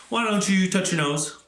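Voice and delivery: plain reading voice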